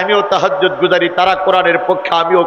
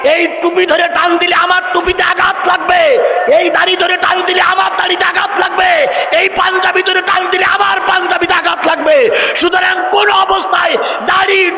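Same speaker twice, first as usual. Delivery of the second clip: protest shout; 14 semitones higher than usual. Acted. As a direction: shout it like a crowd leader defending the cap and beard. Preacher voicing protesters' cry.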